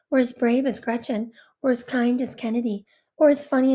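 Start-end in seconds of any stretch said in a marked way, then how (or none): none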